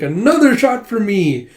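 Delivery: retold boisterous drinker; eager drink order. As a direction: merrily demand another round. Retold tipsy call for another drink.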